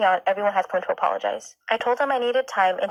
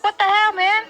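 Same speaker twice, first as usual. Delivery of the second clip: put-on high, loud outraged kid yell; shocked child yelling at the cop; bratty yell; 12 semitones higher, 7 dB louder than usual. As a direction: shout it like an outraged five-year-old. Kid cursing at the officer.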